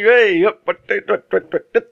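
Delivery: high-pitched mock Cockney babble; mock accent-switching. Friend mimics the actor's accents in one scene, mock Cockney. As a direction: send up a wandering accent with stuttered Cockney nonsense. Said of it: "back to Cockney".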